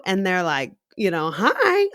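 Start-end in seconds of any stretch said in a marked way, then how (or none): 1.4-1.9 high imitated upbeat hi; jazzed reply. Customer greeting the perky barista back, jazzed. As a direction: answer as if suddenly jazzed. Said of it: "they kinda get jazzed".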